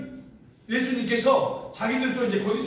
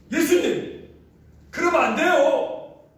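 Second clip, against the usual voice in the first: higher, louder, slowed pleading protest; 높고 크게 항변하듯; Peter protesting; 8 semitones higher, 4 dB louder, slower than usual. Retold or imitated. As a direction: object to Jesus in earnest. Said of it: "항변을 하는 거예요".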